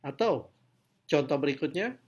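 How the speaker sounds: ordinary talk, nothing marked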